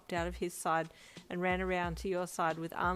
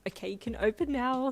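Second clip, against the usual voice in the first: higher, louder excited squeal; squealing excitedly; 6 semitones higher, 6 dB louder than usual. Acted. Brian revealing the surprise to his friend, excited squeal. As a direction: let it burst out with glee. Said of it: "he squealed excitedly".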